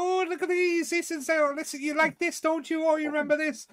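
high, loud puppet chatter; fussing trash woman. Acted. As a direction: press trinkets on them in a high voice.

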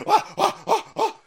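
high, loud mock panicking cries; mock panic. Mocking a lefty panicking over Wi-Fi, shrill mock wail. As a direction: squeal like a panicked kid.

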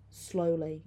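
plain reading voice

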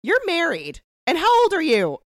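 high, loud, outraged heckle; mock scolding. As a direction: call it out in playful indignation. Mock scolding of show character.

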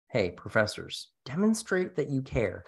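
ordinary delivery

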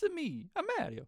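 very high mock Italian accent; Mario. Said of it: "accent is, uh, questionable".

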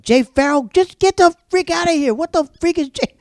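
high, loud exasperated grumbling; frantic high-pitched internal complaint; irritated inner rant. Retold or imitated. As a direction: squeal out the annoyance in your head. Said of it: "This is what I'm saying in my head".

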